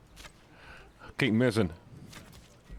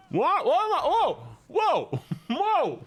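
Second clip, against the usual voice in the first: high-pitched startled whoa; real surprise; surprised shouts; 13 semitones higher, slower than usual. Real, not acted. Surprised by game event.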